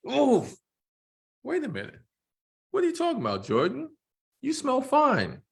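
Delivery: hammed-up dad reacting to the smell; father sniffing his son, amused. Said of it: "I'm gonna try to do voices to distinguish".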